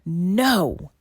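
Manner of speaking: a loud, forceful no; shout. Acted. Mermaid to the sea witch, shouting her down. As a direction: throw all her strength into it. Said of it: "She shouted with a strength".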